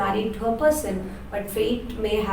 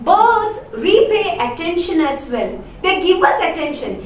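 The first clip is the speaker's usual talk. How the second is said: Laura, fond of birds; 7 semitones higher, 4 dB louder than usual. Acted.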